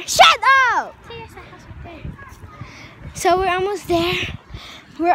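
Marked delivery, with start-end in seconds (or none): 0.0-0.9 shout. Real, not acted. Snapping at companion.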